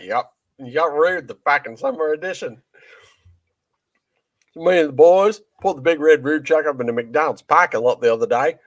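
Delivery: rowdy Aussie comic rant; Australian accent. Retold or imitated. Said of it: "that was a good Australian accent".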